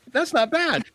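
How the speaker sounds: high, loud retold approval; impressed retort. Fan approving the host's old sign-off line, impressed.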